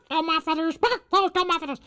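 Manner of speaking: very high squeaky cry for lost feathers; squawking hen wail. Acted. Teacher voices the hen crying over her feathers, squawking high.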